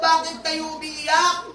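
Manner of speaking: a slow, lightly voiced Paul; slow questioning Paul. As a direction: ask it slowly and gently. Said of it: "Sumagot si Pablo".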